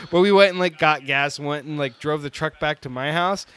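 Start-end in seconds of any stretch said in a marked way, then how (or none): none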